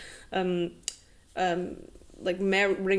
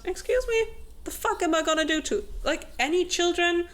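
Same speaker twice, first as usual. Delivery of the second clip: much higher, fast, incredulous; mock offended; 8 semitones higher than usual. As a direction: squeak out the outrage, fast. Grim voiced, offended.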